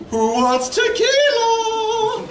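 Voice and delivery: high, loud, drawn-out character quote; theatrical high-pitched call, stretching the words; Joseph Joestar. Acted. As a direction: sing it out in a hammed-up high voice. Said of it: "My favorite Joseph Joestar quote".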